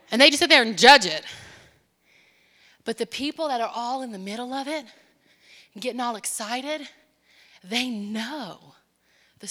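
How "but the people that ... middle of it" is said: ordinary delivery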